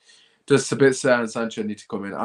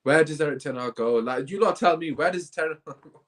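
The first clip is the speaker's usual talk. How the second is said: ordinary talk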